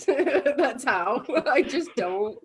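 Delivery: laughing it off; chuckling through the words; amused self-mocking laugh. Real, not acted. Laughing at herself.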